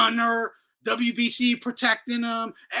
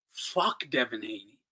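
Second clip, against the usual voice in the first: normal talking voice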